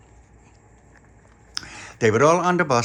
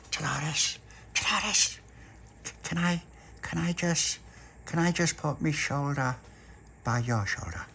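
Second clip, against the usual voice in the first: soft secretive plea; hushed asking; 3 semitones higher, 9 dB quieter than usual. Acted. Charlotte sneaking up to Dolores, asking softly. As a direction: ask quietly so nobody hears.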